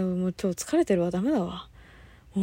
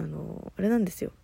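ordinary talk

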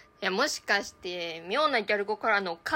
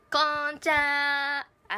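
gyaru greeting; 4 semitones higher, much slower than usual. Acted.

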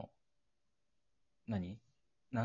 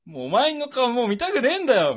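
hammed-up tearful cry, much higher and louder; mock tearful outburst; 11 semitones higher, 7 dB louder than usual. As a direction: sob it out like a TV drama breakup. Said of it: "みたいな感じで言って".

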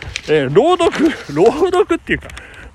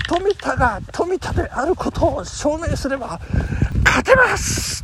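famous sports commentary quote; 6 semitones higher, 3 dB quieter than usual. Retold or imitated. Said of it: "名実況っていうのが".

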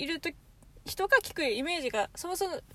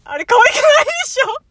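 high laughing protest; laughing whine; 12 semitones higher, 15 dB louder than usual. Real, not acted. Laughing complaint about dialect. (笑いながら抗議)

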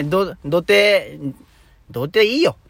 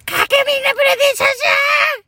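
squeaky, very high cartoon mouse voice; Nyanchu impression; 5 dB louder, much faster than usual. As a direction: shriek it fast like a cartoon critter. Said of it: "にゃんちゅうで答えていただけると".

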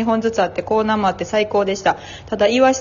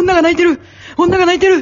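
high-pitched jeering cry; Gunpi impression; 9 semitones higher, 5 dB louder, faster than usual. Retelling the jeer at tearful staff, high mocking shout. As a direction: call it out like a mocking kid, pitched way up. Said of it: "軍備が".

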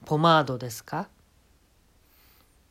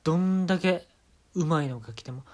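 ordinary delivery

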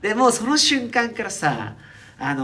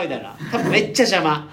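ordinary talk

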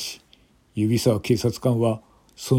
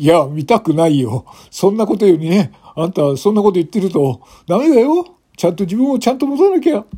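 exasperated officer; 8 semitones higher, 5 dB louder than usual. Acted. Officer lecturing drunk woman.

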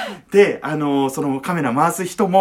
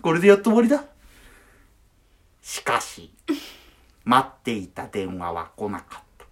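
documentary narration voiceover; 6 semitones lower than usual. Retold or imitated.

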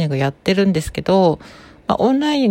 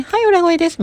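instant falsetto switch; 12 semitones higher than usual. Shown as a demonstration. Voice coach showing a sudden switch into falsetto. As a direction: snap straight into a very high head voice. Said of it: "コロコロ切り替える".

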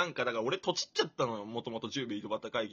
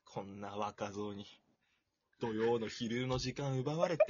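low, slow, sighing mock grumble; imagined inner grumble; 3 semitones lower, slower than usual. Young host voicing his guest's imagined inner complaint, mock grumbling. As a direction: sigh and grumble as if secretly put out. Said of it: "実は内心じゃ".

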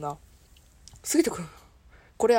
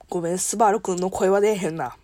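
ordinary talk, nothing marked

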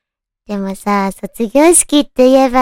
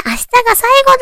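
high, loud eager cry; excited exclamation; 12 semitones higher, 4 dB louder, much faster than usual. Retold or imitated. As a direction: declare it with a rush of feeling. Students thinking before graduation.

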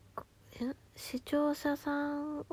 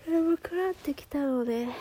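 higher and louder, stressing 眠くなってきた; emphatic repeat; 5 semitones higher, 6 dB louder than usual. Real, not acted. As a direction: punch 眠 hard as you repeat it. (強調して大きく言い直す)

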